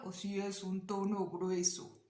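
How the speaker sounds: put-on deep, thick voice. Shown as a demonstration. Teacher asking students to identify their tone, deep voice. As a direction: drop the voice to illustrate a low tone. Voices each tone option as named.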